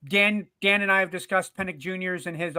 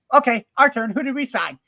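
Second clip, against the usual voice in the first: eager fan; 5 semitones higher, 5 dB louder than usual. Retold or imitated. Retelling the fan video, eager turn.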